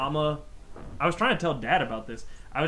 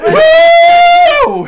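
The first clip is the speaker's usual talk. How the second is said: loud, high whoop with a laugh; joyful whoop; 22 dB louder than usual. Real, not acted. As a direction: let out a big laughing whoop. Whooping at a comedy moment.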